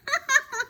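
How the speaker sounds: high taunting giggle; mocking giggle. Classmate giggling at Chrysanthemum's name, mocking. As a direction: giggle at her like a mean classmate.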